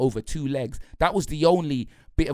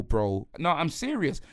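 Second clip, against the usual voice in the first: usual speaking voice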